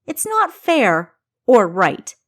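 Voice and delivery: loud, slow, seething protest; ire. A narrator's reading. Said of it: "expressions of ire".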